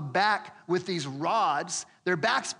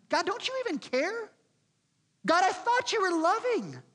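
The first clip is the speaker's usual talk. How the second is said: pleading, climbing much higher to a cry; building from doubt to desperate protest; a sufferer crying out to God; anguished prayer; 5 semitones higher than usual. Retold or imitated. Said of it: "How many times do we pray and say".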